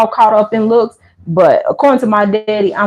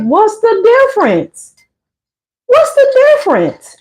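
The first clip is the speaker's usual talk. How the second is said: incredulous; 11 semitones higher than usual. Acted. Vlogger mocking a strict mother's rule, high incredulous squeak. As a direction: squeak it out in disbelief. Mocking outraged question to viewers.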